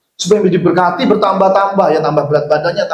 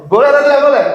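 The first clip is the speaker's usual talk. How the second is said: higher, louder challenge flung at the pews; calling out to the congregation; 10 semitones higher, 4 dB louder, much faster than usual. Real, not acted. Pastor challenging the congregation, loud call-out. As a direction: demand an answer from the room.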